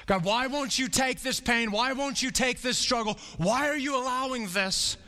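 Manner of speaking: high, plaintive cry to God; pleading complaint. Retold or imitated. Believer in pain to God, a high pleading complaint. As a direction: whine up at God about the pain.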